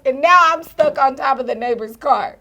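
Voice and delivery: ordinary talk, nothing marked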